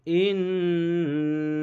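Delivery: ইন্না with the ন্ন held long through the nose; nasal ghunna. Shown as a demonstration. Teacher demonstrating the ghunna to students. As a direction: hum the ন্ন of ইন্না for two beats. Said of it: "গুন্না ওয়াজিব".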